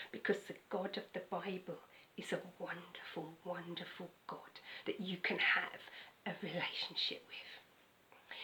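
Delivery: soft, hushed and intimate; tender whisper. Acted. Preacher whispers about a loving God to doubters. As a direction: whisper it like a secret gift.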